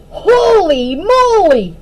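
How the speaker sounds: slow, drawn-out amazed exclaim; surprised shout. Acted. Puddle seeing the big spider, slow amazed shout. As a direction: stretch out the amazement. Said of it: "Puddle shouted".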